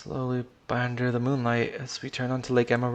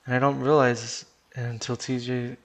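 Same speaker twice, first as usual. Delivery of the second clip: ordinary delivery, nothing marked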